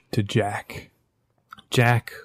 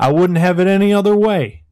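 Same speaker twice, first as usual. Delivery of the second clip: loud, higher, fired up; eager agreement; 8 semitones higher, 7 dB louder, faster than usual. Acted. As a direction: grin into the fight. West eagerly agrees to fight.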